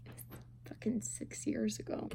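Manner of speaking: hushed, conspiratorial aside; whispered aside. Real, not acted. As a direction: lean in and whisper it. Aside about her age.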